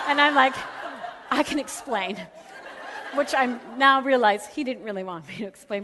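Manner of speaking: talking through laughter; laughing. Real, not acted. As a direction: laugh while you explain.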